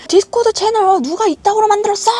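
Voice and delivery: high, loud mock shout; boss yelling. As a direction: imitate a furious boss demanding the culprit. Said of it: "나한테 또 소리를 지르는 거예요".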